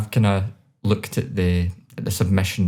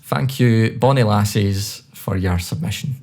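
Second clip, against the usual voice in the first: hammed-up Scottish "Bonnie Lassies"; thanks with a broad Scottish lilt; Scottish accent. Shown as a demonstration. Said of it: "A bit of Scotland coming into that".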